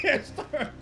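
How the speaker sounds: helpless laughter breaking into the words; high, loud, struggling to speak through laughs; laughing. Real, not acted. Helpless laughter while explaining the rule.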